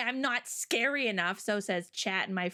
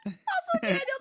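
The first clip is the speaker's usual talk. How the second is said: laughing shriek. Real, not acted. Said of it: "laughing squeal".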